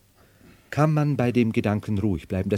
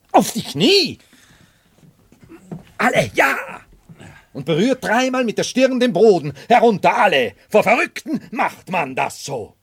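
high, loud barked orders; commanding shout; 12 semitones higher, 7 dB louder than usual. Acted. Madman shouting at his servants to kneel before him. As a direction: rage at them until they kneel.

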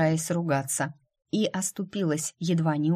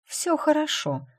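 higher-pitched accusing retort; accusatory; 11 semitones higher than usual. A narrator's reading. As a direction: say it as a reproach, pitched up. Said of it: "обвинительно выдала Джейд". (с обвинением, повыше)

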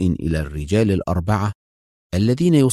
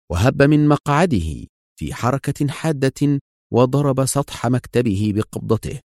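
ordinary delivery

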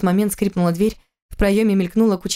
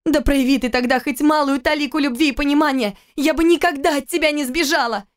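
high, cried-out shout; 8 semitones higher than usual. Acted. Wife screaming at husband by the wall. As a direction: yell it at him. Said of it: "закричала она".

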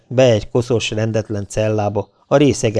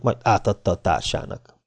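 usual speaking voice

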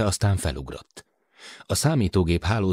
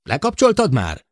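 pushing, higher and louder; impatient; 10 semitones higher, 6 dB louder than usual. Acted. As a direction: hurry him along, impatient. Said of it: "türelmetlenkedett Matt". (türelmetlenül sürgetve)

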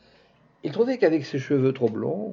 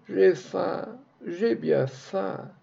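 higher, slow, plaintive wail; beggar's plaint; 7 semitones higher, much slower than usual. Acted. Beggar in the street moaning up at the house, slow plaintive wail. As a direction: drag it out like a thin beggar's lament. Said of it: "la plainte du pauvre montait toujours grêle et pleura".

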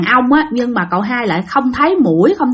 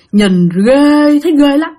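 drawn-out, loud GHÊ; stress on GHÊ; 5 semitones higher, 5 dB louder, slower than usual. Real, not acted. Telling friends how scary the one-eyed ghost looked, GHÊ dragged out. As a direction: stretch out GHÊ loudly with a creeped-out emphasis.

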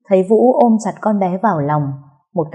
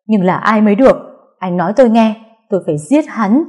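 higher, louder, furious insistence; agitated demand; 3 semitones higher, 4 dB louder than usual. Acted. Sister demanding the culprit's name from Vũ, angrily agitated. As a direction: push hard for the name.

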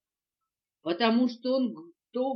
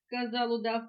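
ordinary delivery

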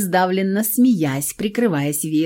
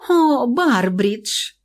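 coy, stretched out; affected; 6 semitones higher, much slower than usual. Acted. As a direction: play coy and mannered. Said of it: "жеманно закатила глаза Брита".

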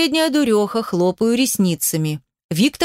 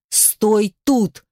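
firm, measured order; strict, no-nonsense instruction; stern command. A narrator's reading. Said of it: "командую строго".

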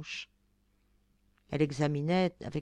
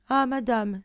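a pleading exclamation, louder and higher; cry out; 5 semitones higher, 5 dB louder than usual. Acted. Blondine cries out, throwing herself at the fairy's feet. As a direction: throw the words out as you fall to her feet. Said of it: "s'écria Blondine en se jetant".

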